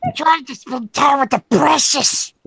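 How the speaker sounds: high creature voice; Gollum voice. Pretend. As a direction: whine about the precious. Gollum voice continued.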